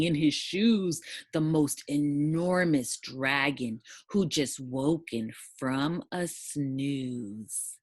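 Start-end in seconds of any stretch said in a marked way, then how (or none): none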